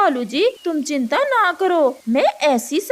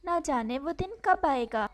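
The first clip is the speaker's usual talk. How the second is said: much softer, gentle storytelling voice; soft story narration; 3 semitones lower, 8 dB quieter than usual. A narrator's reading. A narrator softly tells a sad bird story, hushed. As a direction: read it softly like a bedtime tale.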